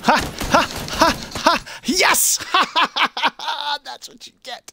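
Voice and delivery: high, loud triumphant laughter; gloating laugh. Real, not acted. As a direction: cackle with glee over the win. Gloating after a kill.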